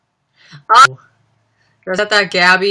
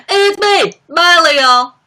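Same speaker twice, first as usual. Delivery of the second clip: high, loud hammed-up pop star; 11 semitones higher, 8 dB louder, slower than usual. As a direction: announce yourself as a brash celebrity parody. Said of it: "impression of [name] on S N L doing [name]".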